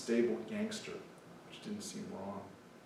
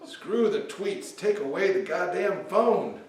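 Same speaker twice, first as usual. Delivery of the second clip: laughing, much louder and higher; 9 semitones higher, 12 dB louder, slower than usual. Acted. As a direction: belt the punchline, laughing. Reading poem punchline.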